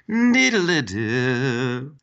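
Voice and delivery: slow sing-song chime imitation; imitated computer chime. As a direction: draw out a little electronic jingle. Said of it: "a Windows chime. ... It went".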